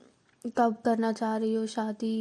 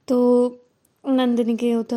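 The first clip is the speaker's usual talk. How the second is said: ordinary talk, nothing marked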